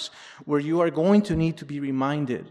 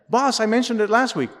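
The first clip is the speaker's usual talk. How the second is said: higher, louder acted protest; mock aggrieved worker, pitched up; protesting employee; 8 semitones higher, 5 dB louder than usual. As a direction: object to the boss who took credit. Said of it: "worked for a boss".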